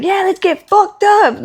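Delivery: high, loud, slow hyped-up party call; mock party hype. To a friend, imitating her night-before self, mock hype. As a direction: ham up the eager party version of yourself. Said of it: "I'll be like".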